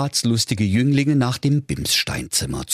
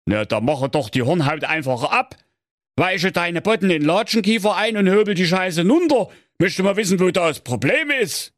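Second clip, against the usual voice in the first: gruff king in Saxon dialect; a grumbling king giving blunt advice; grumpy Saxon king; 10 semitones higher than usual. A narrator's reading. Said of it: "Da sprach der König".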